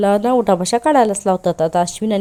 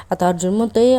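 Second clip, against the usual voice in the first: ordinary talk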